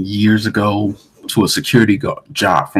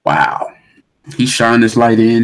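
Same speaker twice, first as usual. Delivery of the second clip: normal talking voice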